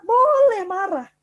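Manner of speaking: slow, loud, high Boleh marah with weight on boleh; emphatic allowance. Shown as a demonstration. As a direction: lean hard and slow into boleh. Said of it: "Loh, kenapa?".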